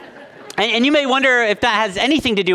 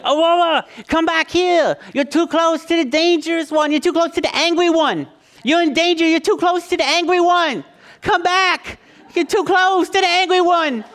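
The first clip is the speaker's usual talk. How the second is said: little son's voice; 11 semitones higher than usual. Retold or imitated. Dad retelling his son warning his sister in a high child voice. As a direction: play the small boy warning his sister. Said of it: "being like".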